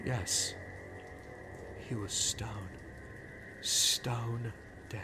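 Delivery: ordinary delivery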